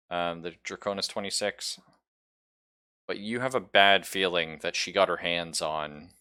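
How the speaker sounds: normal talking voice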